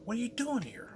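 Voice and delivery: high squeaky startled question; mock surprised girlfriend.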